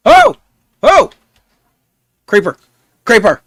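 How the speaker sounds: real panic at a creeper; startled shout. Real, not acted. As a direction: yell out the warning as it jumps you. Creeper suddenly appears.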